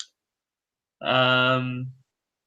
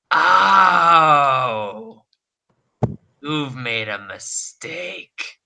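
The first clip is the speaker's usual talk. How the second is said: menacing hag; much slower than usual. Acted. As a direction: gloat like a sinister hag. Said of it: "the mother hag says".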